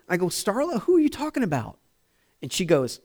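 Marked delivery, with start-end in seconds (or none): 0.3-1.8 high-pitched, softer puzzled husband; bewildered question. Retold or imitated. Confused husband to the woman on the phone, bewildered. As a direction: ask it high, utterly baffled.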